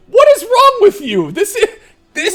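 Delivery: high squealing laugh-talk; helpless tearful laughter; crying with laughter. Real, not acted. GM in hysterics at player.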